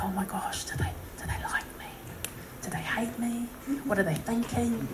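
anxious unvoiced whisper to herself; whispered worry. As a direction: whisper the fearful thoughts under your breath. Anxious inner thoughts before audience.

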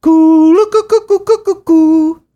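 very high, loud cooing call; imitated bird call. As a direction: coo like a wild bird in a nature film. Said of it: "He would go".

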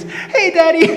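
put-on very high, loud child greeting; happy little boy. Found son greeting his dad from lifeguard booth, happy child voice. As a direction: chirp it out with a big smile. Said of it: "smile on his face".

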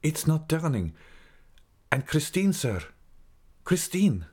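high, urgent pleading; urgent worry. A narrator's reading. Raoul pleads with the Persian over Christine, urgent and high. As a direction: plead urgently, pitched far up.

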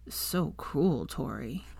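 soft, weary reproach, dying away; quiet. A narrator's reading. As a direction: murmur it faintly, half asleep. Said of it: "he said quietly".

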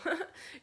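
kurzes, hohes Lachen über sich selbst; kurzes Auflachen. Real, not acted. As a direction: lacht kurz über den eigenen Versprecher. Lacht über eigenen Versprecher. (lacht über ihren Versprecher, kurz und hoch)

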